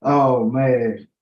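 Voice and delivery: ordinary talk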